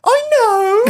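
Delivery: loud, very high retort; mock irritated wife. Wife told how to drill, high mock-indignant retort. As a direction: mimic a high, indignant comeback. Mimics wife snapping back.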